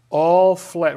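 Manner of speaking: "All" punched high and loud; stress on all. Shown as a demonstration. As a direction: hit the word "All" hard against "many". Contrasting all with just many.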